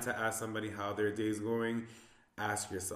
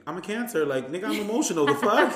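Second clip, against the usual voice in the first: high, loud mock protest to dad; yelling back at him, half laughing; playful shouted defiance; retold outburst; 9 semitones higher, 9 dB louder than usual. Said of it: "And I'd be like".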